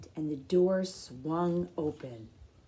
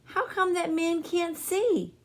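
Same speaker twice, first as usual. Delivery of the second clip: put-on higher, wondering child voice; curious little boy; 11 semitones higher, 4 dB louder than usual. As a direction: ask like a puzzled kid. CJ asking.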